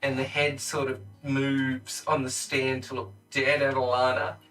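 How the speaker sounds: usual speaking voice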